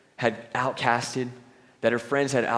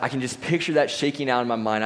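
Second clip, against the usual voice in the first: ordinary talk, nothing marked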